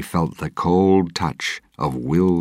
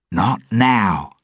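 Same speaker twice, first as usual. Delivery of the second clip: loud, higher angry snap; angrily; 8 semitones higher, 7 dB louder than usual. A narrator's reading. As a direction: cut him off in anger. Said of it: "Jack said angrily".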